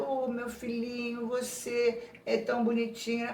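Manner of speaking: put-on soft, slow cooing to a baby; doting mother. Mother murmuring to her baby boy while feeling guilty, doting. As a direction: coo it softly, drawing out the endearment. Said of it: "uma mãe tá dizendo".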